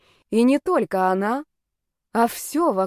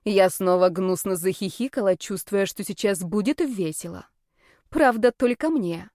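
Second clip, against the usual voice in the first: ordinary delivery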